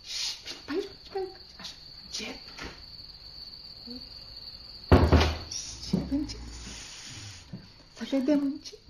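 whispered, urging hush; hushed creeping. Acted. Girl leading her sister past the sleeping guest, hushed whisper. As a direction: whisper softly so no one wakes.

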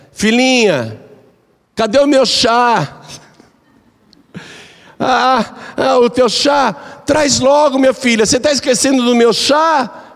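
high, eager voice asking for her tea; sweet old mother-in-law. Acted. The mother-in-law asks her daughter-in-law for tea, high and eager. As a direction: call out for the tea like a fond old lady.

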